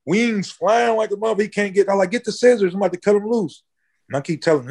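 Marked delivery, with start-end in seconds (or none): 2.1-3.6 high, eager orders to fetch the scissors; urgent call. Retold or imitated. Telling his friends to bring scissors for the bird, high and eager.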